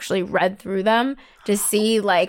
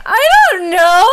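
high, loud whiny wail; exaggerated near-tears cry, pitched way up; mock crying; 8 semitones higher, 10 dB louder than usual. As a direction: wail it helplessly, hamming up the tears. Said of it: "like, crying, basically".

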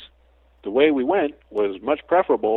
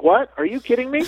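put-on high, incredulous child voice; mock kid asking in disbelief; kid's disbelief; 13 semitones higher, 5 dB louder than usual. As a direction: play a shocked kid, pitch up. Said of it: "they're like".

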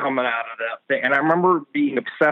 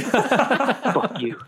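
laughing it out, high; playful insult through laughter; 8 semitones higher than usual. Pretend.

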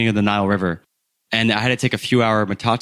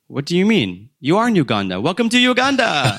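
high, bright-eyed driver, louder as he says welcome; cheery motorbike driver; 8 semitones higher, 3 dB louder than usual. Retold or imitated. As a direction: play the driver proudly announcing the border is behind you. Motorbike driver announces you're in Uganda.

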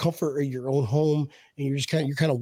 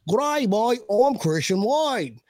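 bad British accent, pitched up and drawn out; 5 semitones higher, slower than usual. Host showing his running-joke accent, mock British. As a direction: put on a hammed-up British accent.

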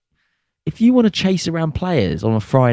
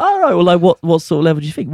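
excited; 7 semitones higher, faster than usual. Retold or imitated. Fishing for compliment, eager.

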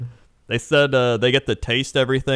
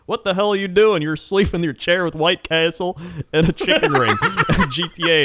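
higher, louder mock scolding with a laugh; fiancee scolding; 7 semitones higher, 3 dB louder than usual. Fiancee scolding him asleep in chair, mock exasperated. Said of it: "she was like".

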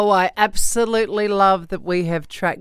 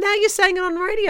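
alarmed; 12 semitones higher than usual. Real, not acted. Co-host alarmed at on-air spoiler.

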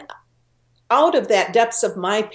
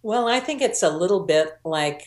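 ordinary talk, nothing marked

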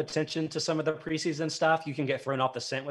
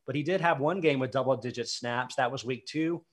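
ordinary talk, nothing marked

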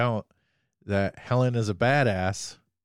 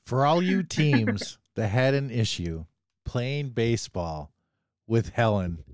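ordinary delivery

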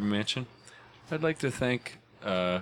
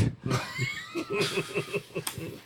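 shrill shouted laugh; 8 dB quieter than usual. Acted. High shout after ad.

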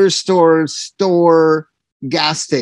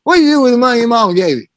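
fast, higher mock clerk voice; store clerk; 7 semitones higher, 3 dB louder, much faster than usual. Clerk repeats his question to the singer, fast and higher. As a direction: fire it off quickly like the clerk repeating himself. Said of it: "the guy goes".

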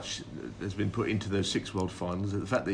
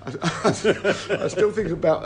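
laughing; 9 semitones higher, 7 dB louder, slower than usual. Real, not acted. Laughing while recalling a funny story.

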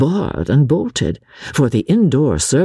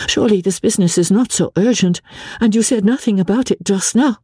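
high and fast, anxious; quick, nervous; 8 semitones higher, faster than usual. A narrator's reading. Wife nervously pressing husband, fast. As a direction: hurry the words, fretting. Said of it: "still speaking quickly and nervously".

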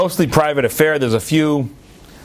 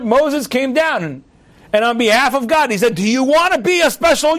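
fervent preaching; 10 semitones higher than usual. Acted. Rabbi preaching Sinai as the wedding with God, fervent. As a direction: proclaim it to the congregation with joy.

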